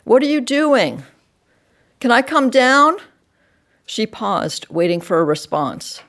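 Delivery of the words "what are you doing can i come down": lightly voiced child, higher and louder; raised, pleading kid's questions; child calling down. A narrator's reading. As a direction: call down with eager questions. Child calls down to parents.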